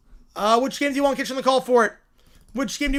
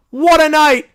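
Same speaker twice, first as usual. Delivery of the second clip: elated shout; 3 semitones higher, 10 dB louder, slower than usual. Real, not acted. Celebrating the finish.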